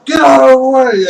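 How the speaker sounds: loud, high demand; angry mob yell. Acted. Mob to the guard in their way, loud angry yell. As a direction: shout it at someone blocking you.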